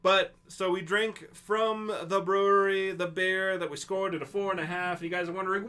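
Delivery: ordinary talk, nothing marked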